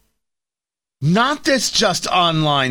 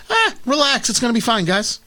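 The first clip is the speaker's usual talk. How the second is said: mock breezy reassurance; 7 semitones higher than usual. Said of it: "His line was".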